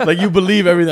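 loud laughing delivery. Real, not acted. Laughing at her gullible thirteen-year-old self, laughing. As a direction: push the words out through laughter. Laughing at own childhood story.

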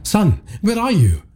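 high, worried call. Acted. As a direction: call out searching for son. Father calling son in dark.

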